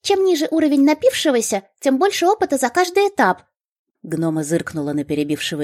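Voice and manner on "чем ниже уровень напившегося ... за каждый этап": high, eager gnome voice; chirpy gnome explaining. A narrator's reading.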